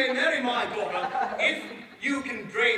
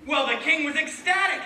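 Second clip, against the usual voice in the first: ordinary delivery, nothing marked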